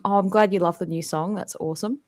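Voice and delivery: ordinary talk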